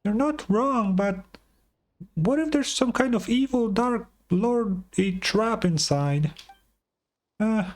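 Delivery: high, bright little companion voice; chirpy high fairy sidekick; Paimon voice. Acted.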